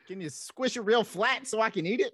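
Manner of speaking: playful put-on Brooklyn voice; Brooklyn accent. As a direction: order in a hammed-up Brooklyn accent. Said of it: "with a Brooklyn accent or a New York accent".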